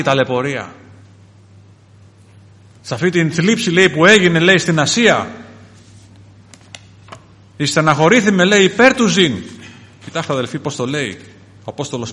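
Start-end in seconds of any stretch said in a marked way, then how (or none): 2.8-9.3 loud, raised preacher's voice; loud, emphatic sermon delivery; forceful preaching. Acted.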